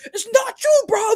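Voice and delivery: high, loud British wrestler plea; mock British wrestler shout. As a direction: shout it like a heated British promo. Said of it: "Ospreay and Swerve start scrapping".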